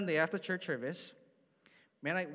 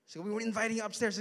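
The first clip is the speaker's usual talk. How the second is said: eager friend; 8 semitones higher, 6 dB louder than usual. Retold or imitated. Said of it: "But he goes".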